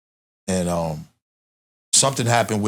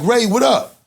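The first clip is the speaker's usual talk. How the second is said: excited greeting; 5 dB louder, slower than usual. Retold or imitated. Styles greets him down the hall.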